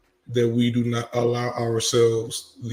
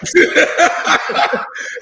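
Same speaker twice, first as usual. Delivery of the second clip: a burst of high, loud laughter; 7 dB louder than usual. Real, not acted. Friend cracks up at the reaction, high laughing. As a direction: cackle at the absurd reaction.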